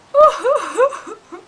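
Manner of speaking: high, loud fake sob; cartoon sob. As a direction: wail like a crying kitten. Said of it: "the cat is crying".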